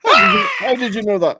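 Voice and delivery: high, fast, shouted disbelief; amazed outburst. Acted. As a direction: blurt it out astonished. Reenacting the bridge scene.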